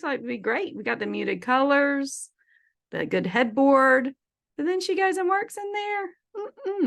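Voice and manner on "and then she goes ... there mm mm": high disapproving mm-mm. Real, not acted. Disapproving of working in bedroom.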